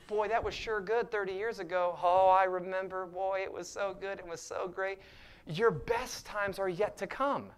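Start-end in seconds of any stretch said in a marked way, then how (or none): 0.1-5.0 higher, wistful mock reminiscing; nostalgic believer. Preacher imitating a complacent believer's wistful reminiscing. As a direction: play a churchgoer living on old glory.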